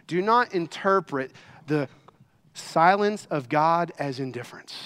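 usual speaking voice

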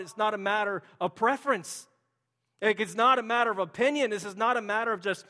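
loud, high, driving pulpit proclamation; rising fervent sermon cadence; preaching at full force. Acted. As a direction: preach it with full conviction to the church. Preaching opening declarations.

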